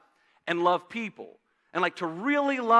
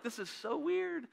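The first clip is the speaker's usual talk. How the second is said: mock awkward listener; 8 semitones higher, 9 dB quieter than usual. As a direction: voice the embarrassed crowd. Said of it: "You're like".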